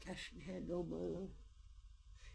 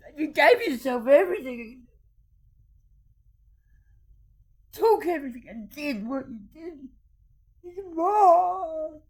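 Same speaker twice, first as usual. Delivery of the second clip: furious crying rant; 8 semitones higher, 14 dB louder than usual. Real, not acted. Accusing those who stole her land, loud and crying. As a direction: shout the accusation as the sobs come.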